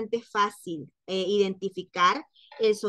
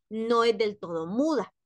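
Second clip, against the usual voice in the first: ordinary delivery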